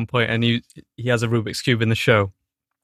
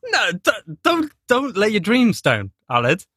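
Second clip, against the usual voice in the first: mock pep talk; 9 semitones higher than usual. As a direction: plead like a cheesy life coach.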